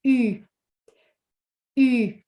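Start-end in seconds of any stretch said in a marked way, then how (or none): 1.8-2.1 U with rounded lips; French U. Shown as a demonstration. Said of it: "we must pronounce that letter".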